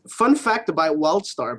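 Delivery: ordinary talk, nothing marked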